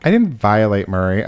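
ordinary talk, nothing marked

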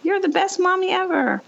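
a hint of an adoring small child; imitating her son. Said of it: "your son looks at you and he's like".

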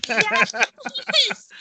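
thrilled, high, loud cry; excited shout. Real, not acted. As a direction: burst out with joy. Hears about the Disney trip.

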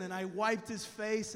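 ordinary talk, nothing marked

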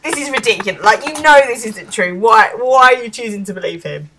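hammed-up outrage at being accused; indignant protest.

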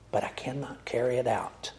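broken whisper of defeat; whispered confession. Acted. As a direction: let the line collapse into a whisper. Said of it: "he cries out".

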